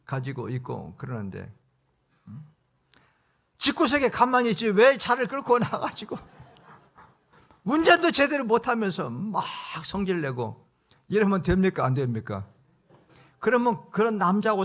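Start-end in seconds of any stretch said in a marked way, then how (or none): 3.6-10.7 higher, louder angry scolding; scolding husband. Retold or imitated. Husband berating his wife over the dented car, angry and loud. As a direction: snap at her like a fed-up husband.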